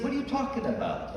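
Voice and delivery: high, incredulous what are you talking about; a baffled husband pushing back; exasperated husband. Retold or imitated. Husband snaps back at his wife, high and incredulous. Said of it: "The husband".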